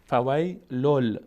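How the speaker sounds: فویلل with a held doubled ل; drawn-out فویلل, ending on lam; nun turned lam. Shown as a demonstration. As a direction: slowly recite فویلل, the final n turned to l.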